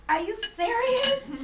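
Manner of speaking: high, loud, slow squeal of disbelief; astonished squeal. Retold or imitated. Retelling finding the perfect teaspoon, squealing in disbelief. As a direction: stretch it out in shocked delight. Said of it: "I was like".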